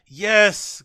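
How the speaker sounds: ordinary delivery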